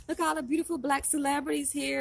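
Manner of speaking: high-pitched, admiring call-out; retold hype. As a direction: imitate boosting them up, voice lifted high. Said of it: "showing them love and boosting them up".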